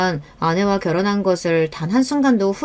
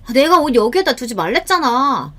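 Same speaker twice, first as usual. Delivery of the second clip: higher-pitched annoyed scolding; irritated wife; 5 semitones higher than usual. Acted. Wife scolding husband over clothes left out, irritated. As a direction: nag in exasperation. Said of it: "짜증이 굉장히 많이 늘었습니다".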